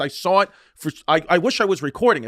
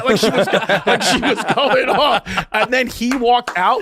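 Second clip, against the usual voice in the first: laughing; 6 semitones higher, 5 dB louder, slower than usual. Real, not acted. Laughing while telling.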